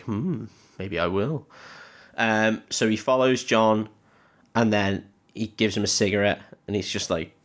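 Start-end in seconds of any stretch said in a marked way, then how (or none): 0.0-1.5 mock pondering. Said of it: "Gruber's face is like".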